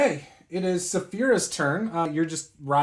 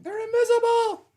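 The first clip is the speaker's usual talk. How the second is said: screaming kobolds; 3 dB louder than usual. Acted. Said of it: "hear them screaming".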